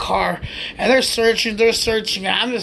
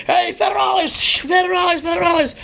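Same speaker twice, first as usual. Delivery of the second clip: high calls of federales; warning shout; 9 semitones higher than usual. Retold or imitated. People warning the group that police are coming, high shouted warning. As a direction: call out a warning to friends. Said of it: "people are warning".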